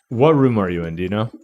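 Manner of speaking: usual speaking voice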